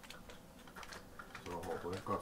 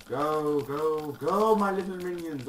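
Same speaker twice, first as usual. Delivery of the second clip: high loud evil overlord; villain commanding minions; 7 semitones higher, 9 dB louder, slower than usual. Pretend. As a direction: shout orders to your minions.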